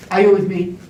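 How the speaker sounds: usual speaking voice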